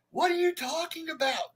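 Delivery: high, frantic mock squeal; frantic coworker. Mimicking a coworker panicking at the counter, high squeal.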